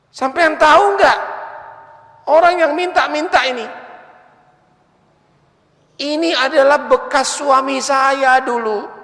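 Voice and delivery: high, urgent woman's voice, a little fast; lightly voiced wife. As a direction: tell him the secret in a high, urgent wife's voice. Wife reveals beggar is ex-husband. (istri membuka rahasia dengan suara perempuan tinggi)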